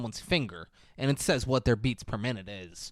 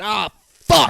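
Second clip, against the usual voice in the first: loud, higher curse on impact; mock pained yell; 7 semitones higher, 10 dB louder than usual. Girl smacking into the window, mock pained yell. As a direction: act out a painful crash with a yell. Imagines jumper hitting the window.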